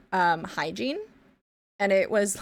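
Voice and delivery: ordinary talk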